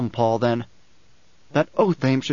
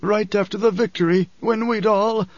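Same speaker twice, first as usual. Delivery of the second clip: high, strained wheeze of a sick man; wheezing; 7 semitones higher than usual. A narrator's reading. Sick Othame tells Usul of his disease, wheezing. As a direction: wheeze it out in a raised, ailing voice. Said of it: "he wheezed".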